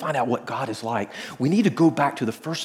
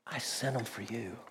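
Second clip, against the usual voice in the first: much softer, low and tender; gentle voice of God; 3 semitones lower, 14 dB quieter than usual. Retold or imitated. God answering the surfer, soft and tender. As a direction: say it quietly like a loving father. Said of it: "He said".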